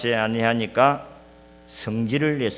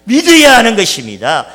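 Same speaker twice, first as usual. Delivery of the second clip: louder, higher preaching climax; fiery preaching; 10 semitones higher, 13 dB louder than usual. Acted. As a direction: drive the point home to the congregation. Preaching at full force.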